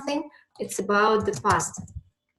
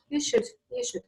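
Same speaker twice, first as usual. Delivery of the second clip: high, soft, insistent "you should"; emphatic advice; 6 semitones higher, 6 dB quieter, faster than usual. Shown as a demonstration. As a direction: press the advice home. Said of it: "and I say to you".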